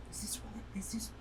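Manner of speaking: fast unvoiced whisper. Real, not acted. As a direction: whisper it quickly to the pendant. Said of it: "why were you whispering that thing".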